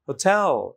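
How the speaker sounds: "Hotel" with stressed, falling "টেল"; second-syllable stress. Shown as a demonstration. Teacher recaps the exceptions, second-syllable stress. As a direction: say "hotel" as ho-TEL. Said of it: "এর কিছু ব্যতিক্রমও আছে".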